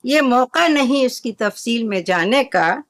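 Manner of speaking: usual speaking voice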